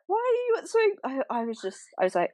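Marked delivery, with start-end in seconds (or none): 0.1-1.0 high, baffled question; can't believe what she's hearing; exasperated disbelief. Retold or imitated. Exasperated quote to husband.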